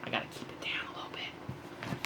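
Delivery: hushed whisper so the wife sleeps. Real, not acted. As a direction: whisper it, barely any voice. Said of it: "The wife is asleep next door".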